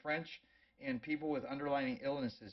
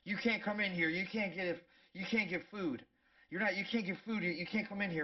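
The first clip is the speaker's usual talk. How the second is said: mock panicked staff; 6 semitones higher than usual. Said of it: "freaking losing their mind".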